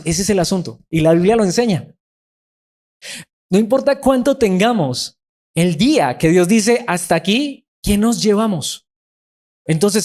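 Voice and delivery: high, driving preacher's voice; preaching at full force. Acted. Pastor pressing his flock on riches, preaching with rising force. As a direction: lift the congregation with a rising pulpit cry.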